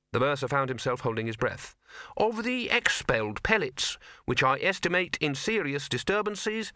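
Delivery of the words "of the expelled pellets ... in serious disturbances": high, slow, pedantic reading; fussy scholar reading. Acted. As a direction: read it like a nervous academic. Bursar reading, rising.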